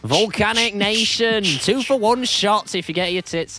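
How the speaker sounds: loud, slow, hammed-up club night MC voice; mock club promoter. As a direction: sell the cheap drinks like a tacky promoter.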